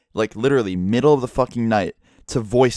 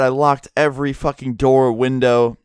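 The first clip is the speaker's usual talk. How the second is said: ordinary talk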